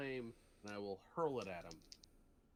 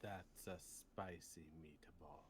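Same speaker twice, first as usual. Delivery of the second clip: low, slow, soft aside; understated catchphrase quip; 5 semitones lower, 9 dB quieter, much slower than usual. Pretend. Player joking after the smoking kill, deadpan slow quip. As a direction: draw it out softly and low.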